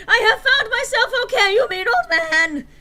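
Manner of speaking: very high, loud laughing yell. Acted. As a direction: scream it at the old man. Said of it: "Hagrid yelled".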